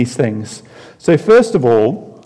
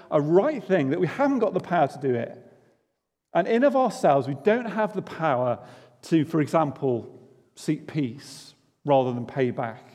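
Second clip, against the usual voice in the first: ordinary delivery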